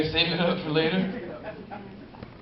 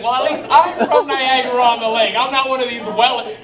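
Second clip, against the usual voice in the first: mom's retort; 5 semitones higher, 4 dB louder than usual. Retold or imitated. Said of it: "She's like".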